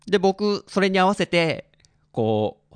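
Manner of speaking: usual speaking voice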